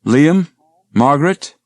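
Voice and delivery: loud, high calling through the house. Acted. Woman calls loudly for family in a dark house. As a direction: call out to the empty house. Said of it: "Sarah called".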